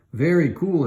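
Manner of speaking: impressed. Acted. Eric impressed.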